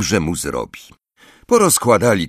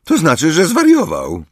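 higher, loud, half-admiring; almost admiring; 10 semitones higher, 5 dB louder than usual. A narrator's reading. Ron reacting to Hagrid's plan, almost admiring. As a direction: marvel at the madness. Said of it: "prawie z podziwem".

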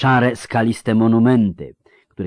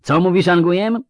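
high, raised voice with rising end; shouted question; 12 semitones higher, 7 dB louder than usual. A narrator's reading. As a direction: call back louder through the storm. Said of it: "przekrzykując wichurę". (głośne pytanie przekrzykujące wichurę)

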